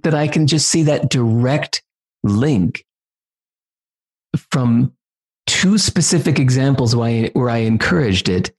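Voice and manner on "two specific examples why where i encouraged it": ordinary talk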